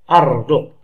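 أرض hit loudly with a glottal stop at the start; hamza pronounced. Shown as a demonstration. Teacher showing a cutting hamza, أرض with a loud hard onset. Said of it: "هنا أنطقها لأنها همزة قطع".

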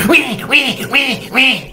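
put-on high, loud baby wail; squealing infant imitation. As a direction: imitate a baby crying out wee wee. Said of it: "Then they never have to do that again".

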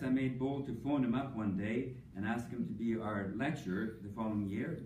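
ordinary delivery

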